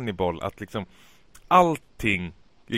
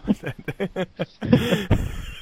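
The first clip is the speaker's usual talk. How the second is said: high chuckling in a long run; laughter; 10 semitones higher than usual. Real, not acted. Host laughing at the botched title, high chuckle. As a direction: laugh helplessly, high and bubbling.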